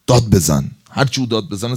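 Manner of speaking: sudden loud urging, egging on; mock shout. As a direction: bark the order like a tempter. Satan urging to shout back. (داد زدن تمسخرآمیز)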